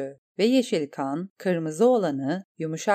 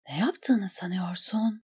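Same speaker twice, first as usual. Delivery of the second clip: much softer, breathy inner whisper; whispered thought; 4 dB quieter than usual. A narrator's reading. Eriyen thinks of the reckless knight, whispered. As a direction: hiss the thought under her breath.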